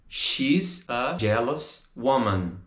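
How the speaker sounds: "She's, a, jealous, woman" with gaps; slow, separated model. Shown as a demonstration. Teacher models the answer, word by word.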